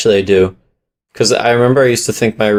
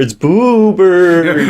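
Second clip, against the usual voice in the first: silly drawn-out name; 8 semitones higher, much slower than usual. Pretend. Joking with the name.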